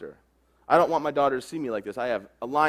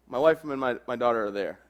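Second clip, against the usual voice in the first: ordinary talk, nothing marked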